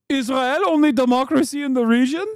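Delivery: put-on high, incredulous Israeli-accent question; mock Israeli accent. Israel defender challenging a critic, in a high mock Israeli accent. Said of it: "please stop with this accent".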